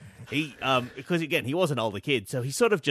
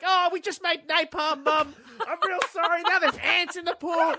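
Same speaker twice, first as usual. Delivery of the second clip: put-on innocent kid; 12 semitones higher than usual. Kid confessing to mum.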